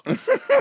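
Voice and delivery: sudden high squeal of a laugh; high burst of laughter. Real, not acted. Telling friend about the shirts referral, squealing laugh.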